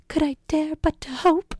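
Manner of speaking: high, dramatic period-heroine voice; swooning heroine. Retold or imitated. Elizabeth daring to hope about Darcy, high swooning voice. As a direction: sigh it like a lovestruck heroine.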